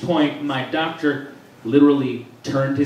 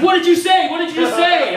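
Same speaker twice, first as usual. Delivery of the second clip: high, loud, freaked-out dentist yelling at the patient; alarmed shout; 13 semitones higher, 6 dB louder than usual. Retold or imitated.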